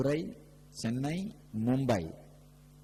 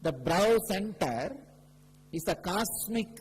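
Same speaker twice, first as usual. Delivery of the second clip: fervent preaching; 6 semitones higher than usual. Acted. Guru preaching about the third eye to devotees, raised and fervent. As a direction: proclaim it to the whole hall.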